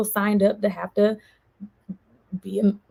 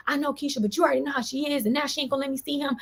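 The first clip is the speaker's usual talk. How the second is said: higher, softer, fast mock-Jeremy pleading; imitating Jeremy; 7 semitones higher, 5 dB quieter, faster than usual. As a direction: play the boyfriend, higher and hurried. Jeremy voiced in retold argument.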